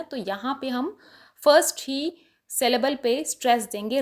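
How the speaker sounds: usual speaking voice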